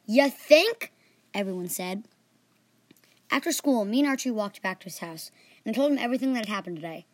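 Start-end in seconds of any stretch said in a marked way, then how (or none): 0.1-0.9 loud, high ya think; sarcastic retort. A narrator's reading. Whole class mocks Jose, loud and sarcastic. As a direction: fire it back mockingly.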